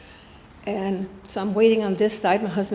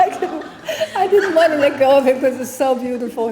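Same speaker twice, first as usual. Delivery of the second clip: louder, higher, laughing through the words; 5 semitones higher, 8 dB louder than usual. Real, not acted. Remembering holding Swami's feet, laughing loudly. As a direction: laugh while you say it. Laughing while recalling clinging.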